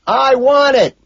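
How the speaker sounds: high, loud demand; full-volume holler; shouting. A narrator's reading. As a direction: yell it over the din. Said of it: "I shout".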